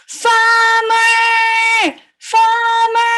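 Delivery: big, high, sing-song shout; calling out loud. Acted. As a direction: holler with long held vowels. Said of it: "Let's call the farmer out loud".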